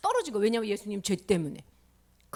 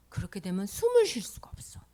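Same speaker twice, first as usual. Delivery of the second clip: ordinary talk, nothing marked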